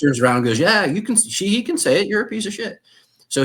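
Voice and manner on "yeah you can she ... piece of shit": higher-pitched imitation of wife snapping back; raised, indignant mock-wife voice; imitated defiant retort. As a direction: mimic the wife backing up the speaker. Imitating wife's retort to the chief.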